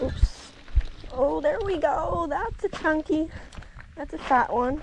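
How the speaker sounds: high, slow, thrilled; hooting over a chunky fish; excited. Real, not acted. Fish on the line.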